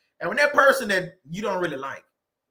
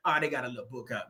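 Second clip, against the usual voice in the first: lower, softer mocking little book; dismissive sneer; 8 semitones lower, 8 dB quieter, faster than usual. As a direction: belittle it under your breath. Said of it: "somebody somewhere".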